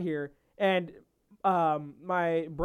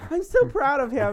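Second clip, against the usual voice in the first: higher, louder gushing mom quote; proud mom; 7 semitones higher, 5 dB louder than usual. Retold or imitated. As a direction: gush like a proud mother. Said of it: "My mom is like".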